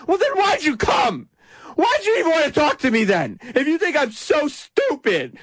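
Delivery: put-on petulant teen outburst, very high-pitched; Gary Johnson. Host mimics candidate snapping at a reporter, mock teenage tantrum. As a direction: whine it indignantly like a wounded teenager. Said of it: "acting like a teenager".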